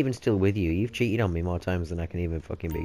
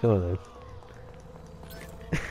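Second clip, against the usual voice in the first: ordinary delivery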